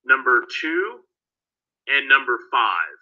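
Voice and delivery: usual speaking voice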